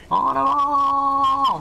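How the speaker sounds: very high, very slow yell; drawn-out battle cry. Pretend. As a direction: stretch it into a war cry.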